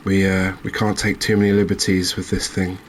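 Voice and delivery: ordinary delivery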